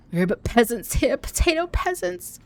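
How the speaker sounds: put-on peasant, climbing very high; mock peasant voice. Streamer joking with chat as a potato peasant, high mock voice. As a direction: play a humble peasant, pitch rising into a squeak.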